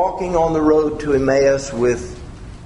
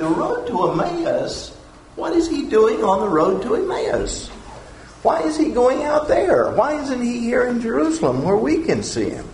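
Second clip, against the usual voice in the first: higher, questioning disbelief; puzzled apostles; 8 semitones higher than usual. Pretend. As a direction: wonder aloud why he's out there. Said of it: "the other apostles are thinking".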